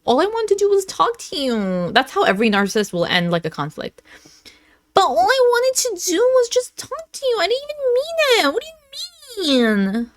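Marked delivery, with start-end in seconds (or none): 0.0-1.9 higher, lightly whiny plea; mock pleading. Partner ends the conflict as victim, mock pleading. Mocks the narcissist's closing plea. 4.9-10.1 very high, squeaky victim whine; mock wailing. Narcissist playing victim after a conflict, mock wailing. Exaggerated mocking of the victim act.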